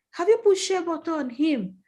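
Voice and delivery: sister repeats. Retold or imitated. Sister repeats her question.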